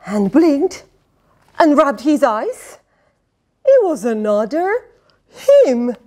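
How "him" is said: ordinary delivery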